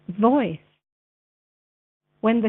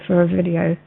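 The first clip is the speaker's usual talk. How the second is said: ordinary talk, nothing marked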